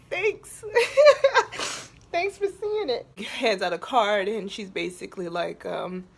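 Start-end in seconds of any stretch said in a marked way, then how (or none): 0.1-3.1 high laugh through the tears; laughing thanks. Real, not acted. Thanking the speaker for seeing her, laughing. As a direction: laugh it out, grateful.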